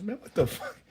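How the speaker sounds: high-pitched, incredulous outburst; mock astonishment. Man stunned by her sudden English, retold in mock astonishment. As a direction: pitch shoots way up in hammed-up disbelief.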